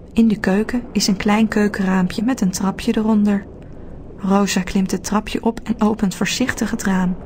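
ordinary delivery, nothing marked

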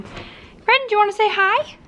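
high, sing-song voice for the cat; pet talk. Real, not acted. Talking to her pet cat.

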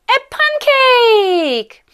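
high, slow, drawn-out announcement; grand storytelling reveal, pitched high; excited reveal. A narrator's reading. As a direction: stretch it out like a big surprise.

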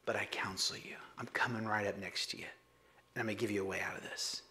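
whispered tender invitation to come close; gracious whisper. Acted. Jesus whispers an invitation to the lukewarm church. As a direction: lean in and whisper the way out. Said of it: "the most gracious invitation ever".

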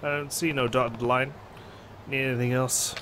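low, gruff tough-guy voice; deadpan, low and tired. Acted. Kiryu replies in game.

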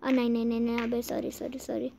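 soft, low little protest; subdued apology. Real, not acted. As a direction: apologise softly, pitched low. Small slip, apologising to character. (दबी आवाज़ में माफ़ी)

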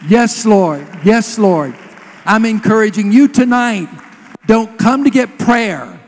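emphatic preacher's delivery; fervent preaching. Real, not acted. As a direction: urge the congregation on with full conviction. Exhorts and praises before congregation.

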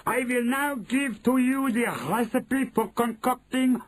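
shouting witch. A narrator's reading. Grand High Witch dictating a recipe, high shout. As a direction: proclaim the formula at high pitch. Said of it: "Grand High Witch was shouting".

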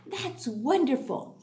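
ordinary delivery, nothing marked

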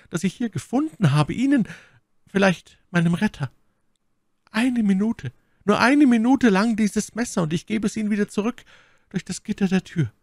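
high, frantic pleading; desperate Milady. Acted. Milady begs Felton for the knife, desperately imploring. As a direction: beg for mercy in breathless bursts, pitch far up.

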